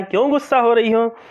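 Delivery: a lightly voiced husband coaxing his cross wife. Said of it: "अपनी पत्नी से कहता है".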